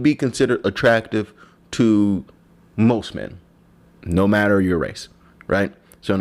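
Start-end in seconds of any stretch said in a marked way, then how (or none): none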